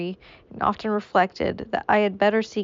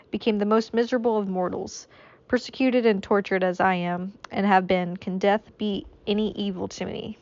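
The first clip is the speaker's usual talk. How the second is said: ordinary delivery, nothing marked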